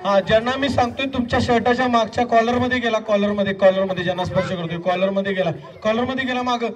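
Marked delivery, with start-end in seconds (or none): 5.8-6.7 high, excited rapid-fire urging; frantic play-by-play of a mosquito chase; urgent chase. Pretend. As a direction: urge them on to catch it before it escapes. Urging them to catch imaginary mosquito.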